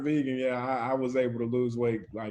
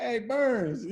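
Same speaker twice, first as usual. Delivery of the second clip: lightly voiced, higher friendly hello; a touch of the coach; 10 semitones higher than usual. Coach greeting his former player, lightly voiced and cheerful. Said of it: "that's how he talks".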